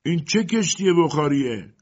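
high, loud indignant cry; shouting. A narrator's reading. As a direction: shout it out as an outraged question. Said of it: "ماهون فریاد زد". (با فریاد)

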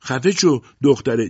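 high, loud snapped shout. A narrator's reading. Mother shouting at her daughter to shut up, loud shout. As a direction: bark it at the girl. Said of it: "روزا فریاد زد".

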